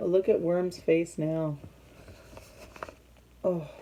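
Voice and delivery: plain reading voice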